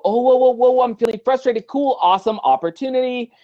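high, bright whoa, whoa, then cheerful; mock-cheerful realization; upbeat surprise. Shown as a demonstration. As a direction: catch yourself and cheer up. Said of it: "become present and go".

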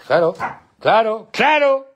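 high, loud and climbing; insistent calling. Real, not acted. Owner repeating a word at his dog, insistent call.

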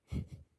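short bright laugh; a quick laugh breaking through. Real, not acted. Laugh mid compliments.

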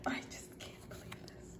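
breathless awed whisper; real hushed awe. Real, not acted. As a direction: whisper in disbelief. Emotional murmur.

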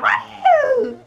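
very high, loud "woo"; wild whoop. Acted. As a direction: whoop as if going wild on camera. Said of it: "I'd be like".

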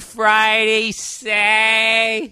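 very slow, loud Boston "Saturday" with its consonants swallowed; Boston accent. Shown as a demonstration. Host exaggerating the Boston accent, very slow. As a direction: drag out "Saturday" Boston style. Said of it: "There's no consonants in that word".

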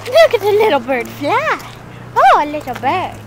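high, loud delighted cry; excited. Real, not acted. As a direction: gush at the tiny bird. Excited about a bird.

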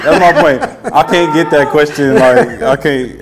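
loud, high laughing protest; real laughter through loud words. Real, not acted. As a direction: laugh it out, can't believe the question. Laughing at the cake answer.

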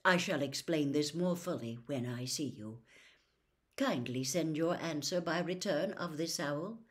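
ordinary delivery, nothing marked

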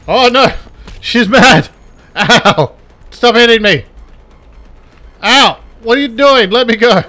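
high, loud mock panic; alarmed shouting. Gamer being hit by an angry woman, alarmed shouting. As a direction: yelp and protest under attack. Character attacked in game fight.

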